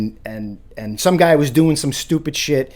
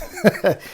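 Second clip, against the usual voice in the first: short laugh; 7 semitones higher than usual. Real, not acted. Laughs at own line.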